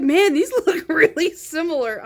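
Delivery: loud, high laughing self-mockery; laughing at herself. Real, not acted. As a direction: laugh at your own blunder. Laughing at her own mistake.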